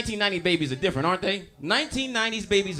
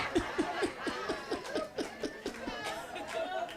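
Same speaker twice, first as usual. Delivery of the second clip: high breathy laugh; 13 dB quieter than usual. Real, not acted. Laughing at own joke.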